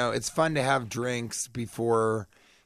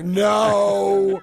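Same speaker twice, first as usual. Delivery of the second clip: put-on higher, louder drunk woman's no; a stubborn drunk heckler; heckler's no; 6 semitones higher, 7 dB louder than usual. Heckling girl refusing the comic, higher louder no. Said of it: "one girl who just kept going".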